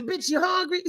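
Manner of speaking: a wife cooing at her man, laughing; mock sweet talk. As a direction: coo it with a laugh. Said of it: "You be the".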